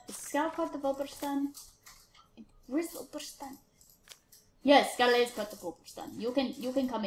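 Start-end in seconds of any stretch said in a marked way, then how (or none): 0.1-3.6 whisper. Real, not acted. Wondering aloud to himself.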